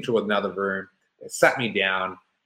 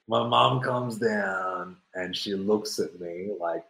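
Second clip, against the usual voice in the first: ordinary talk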